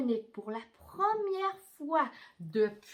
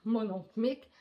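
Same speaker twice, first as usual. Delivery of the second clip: usual speaking voice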